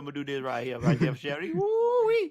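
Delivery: loud, hammed-up Cajun bayou voice; high, whooping Cajun caricature. Shown as a demonstration. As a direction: holler it like an over-the-top Cajun. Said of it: "Do Cajun".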